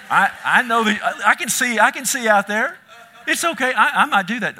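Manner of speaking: high, loud excited reassurance. Real, not acted. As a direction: reassure the crowd, laughing and excited.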